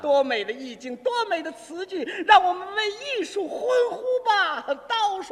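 mock-lofty rapture building to a loud high cry; rapturous poet. Poet admiring his own verse calls for water, rapturous. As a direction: proclaim it as if hailing great art.

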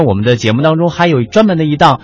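usual speaking voice

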